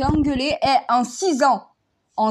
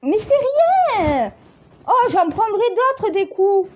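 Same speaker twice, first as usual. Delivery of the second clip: mock grandiose; 9 semitones higher, slower than usual. Mock-dramatic shrug after fight.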